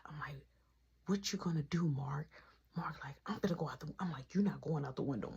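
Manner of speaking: retold in a low, very soft whisper; sneaky, half-whispered back-and-forth; hushed plotting. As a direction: keep it down so nobody catches you. Retelling sneaky plotting at night.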